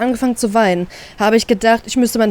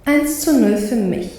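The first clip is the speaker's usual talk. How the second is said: smug inner grin; 2 semitones higher than usual. Acted. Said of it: "grinse ich innerlich".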